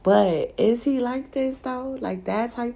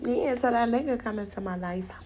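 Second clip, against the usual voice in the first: ordinary talk, nothing marked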